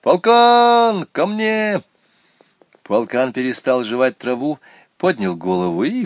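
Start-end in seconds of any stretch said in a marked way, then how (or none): 0.0-1.8 high, loud call across the whole street; shouting down the street. A narrator's reading. Boy calls his goat from the window, shouting. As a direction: call the goat in from far away. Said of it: "закричал на всю улицу".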